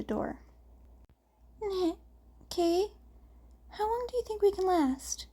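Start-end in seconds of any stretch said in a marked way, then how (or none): 2.5-5.3 lightly voiced young twin, higher; a boy's worried question, a bit higher and quicker; hint of a younger boy's voice; character voice. A narrator's reading. Twin speaking to brother, voiced.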